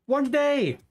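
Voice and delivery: squeaky high teasing disbelief; high mock outrage. Real, not acted. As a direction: rib him in a high voice. Teasing friend about kill count.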